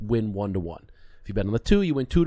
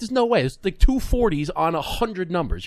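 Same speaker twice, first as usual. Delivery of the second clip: incredulous insisting; 6 semitones higher than usual. Retold or imitated. Insisting to dealer, retold.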